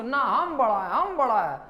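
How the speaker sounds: mock shouting. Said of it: "चिल्लाना शुरू".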